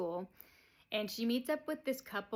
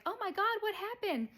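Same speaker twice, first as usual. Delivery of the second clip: much higher, louder startled cry; hammed-up shriek of surprise; shocked alarm; 13 semitones higher, 4 dB louder than usual. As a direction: gasp it out in mock alarm. Rosemary alarmed by smashed egg.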